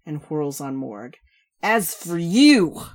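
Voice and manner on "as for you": loud, high, drawling tipsy rant; slow boozy complaining; brash drunk woman. Acted. Shal turns on Morg.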